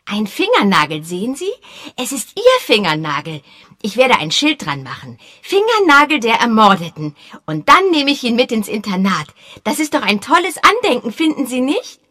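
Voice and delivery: put-on high, loud boyish voice; proud eager boy. A narrator's reading. Boy Peter proudly showing his souvenir, high eager voice. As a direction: show off a treasure like a keen kid.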